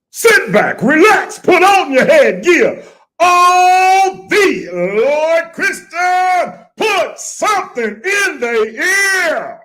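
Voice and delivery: very high, loud, stretched catchphrase; hype show announcer. Acted. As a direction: holler it like a hype man, dragging it out.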